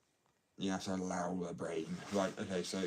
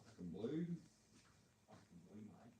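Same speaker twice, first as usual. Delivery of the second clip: soft, lightly put-on Australian accent; casual Australian accent, spoken softly; 12 dB quieter than usual. Shown as a demonstration. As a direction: slip into a quiet Australian twang. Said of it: "tweak my accent to sound a little bit more Australian".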